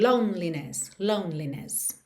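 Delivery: "Loneliness" said as three syllables, lone-li-ness; no e between "lone" and "li" in "Loneliness"; silent middle e. Shown as a demonstration. Teacher showing the silent e in "Loneliness". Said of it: "esse E do meio não é pronunciado".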